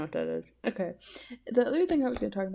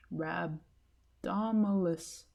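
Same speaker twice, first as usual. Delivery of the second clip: 1 sounding out again slowly; 2 semitones lower, 4 dB quieter, much slower than usual. Shown as a demonstration. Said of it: "What is that word?".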